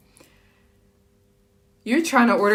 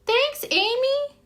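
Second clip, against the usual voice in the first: squeaky thanks; 3 dB louder, slower than usual. Real, not acted.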